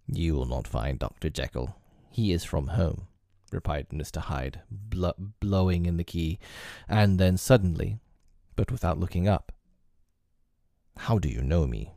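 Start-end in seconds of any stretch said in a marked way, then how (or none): 0.1-3.1 lower, terse sinister voice; cold and short with him; low curt Hyde. Acted. 11.0-11.9 low, wary question; low and guarded; suspicious Hyde. Acted. Hyde suddenly asks.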